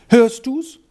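high, alarmed question, asked twice; a narrator's frightened wife hearing noise; startled. A narrator's reading. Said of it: "fragte Frau Castella erschrocken".

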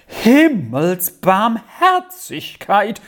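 high, slow, drawn-out exclamation; shocked cry. A narrator's reading. Aunt sees her bloodstained niece, shocked cry. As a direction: cry out in horror, stretching the word. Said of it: "schrie die Tante bei dem Anblick auf".

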